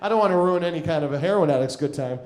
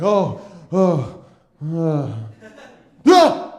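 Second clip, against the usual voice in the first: exhausted groaning cry; 11 dB louder, much slower than usual. Retold or imitated. Collapsing exhausted in an alley.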